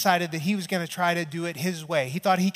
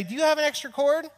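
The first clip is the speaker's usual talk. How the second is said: wife asking; 10 semitones higher, faster than usual. Retold or imitated. Pastor voices his wife asking.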